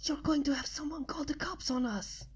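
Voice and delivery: sharp hush with a hand over her mouth; hissed. A narrator's reading. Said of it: "I hissed".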